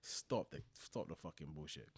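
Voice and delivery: ordinary delivery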